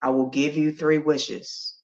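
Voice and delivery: low, soft tree voice; slow and gentle, like an ancient tree; wise old apple tree. Acted. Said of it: "says to Johnny".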